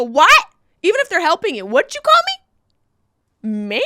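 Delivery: loud, high, offended What; mock outrage, loud and high; indignant snap. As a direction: bristle at being called ma'am. Acting offended at being called ma'am.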